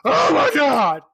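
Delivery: high, loud drawn-out shriek; startled scream. Acted. Candy man surprised in the shower, shrieking in shock. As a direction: cry out in comic shock, high and slow.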